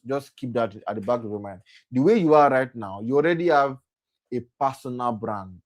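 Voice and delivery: ordinary delivery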